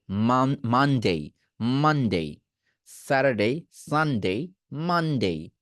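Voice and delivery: মানডে, স্যাটারডে, সানডে with nasal ম and ন; nasal day names. Shown as a demonstration. Teacher drilling day names with the nose vibrating. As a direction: let the nose buzz on the ন. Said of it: "দেখুন আমার নাক কাঁপছে".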